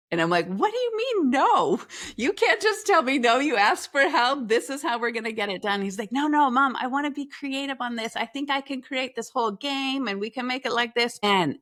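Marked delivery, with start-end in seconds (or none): 0.5-5.8 exasperated mom. Retold or imitated. Mom argues with refusing son. 6.1-11.2 son pleading. Retold or imitated.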